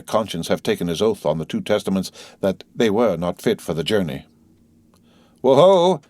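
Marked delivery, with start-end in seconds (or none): 5.4-6.0 1 coachman's call. A narrator's reading. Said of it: "said the coachman".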